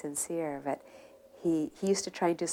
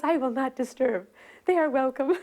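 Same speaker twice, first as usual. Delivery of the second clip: put-on high, louder old man's voice; voicing the old man; 7 semitones higher, 5 dB louder than usual. Old Vrindavan resident telling his visitors, high and proud. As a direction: lift into a much higher, eager old man.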